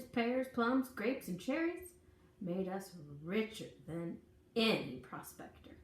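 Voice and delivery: ordinary delivery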